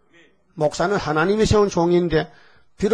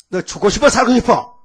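raised, louder threatening demand; angry scolding; 4 semitones higher, 4 dB louder than usual. Acted. God scolding Miriam and Aaron in wrath, angry scolding. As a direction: tell them off hard, voice climbing. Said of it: "막 야단치는 거예요".

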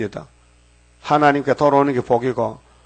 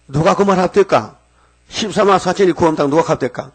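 higher, louder challenging rebuttal; indignant retort; 5 semitones higher, 3 dB louder than usual. Retold or imitated. Preacher retelling how he challenged the caller, raised voice. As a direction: throw the question back sharply. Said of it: "그 내가 반문했어요".

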